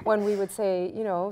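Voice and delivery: ordinary delivery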